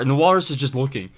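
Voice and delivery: ordinary talk, nothing marked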